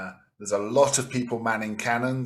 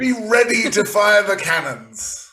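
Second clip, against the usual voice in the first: high, loud posh British orders; posh British accent; 9 semitones higher, 5 dB louder than usual. Acted. Captain readying crew at the cannons, posh British accent. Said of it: "he says in a posh British accent".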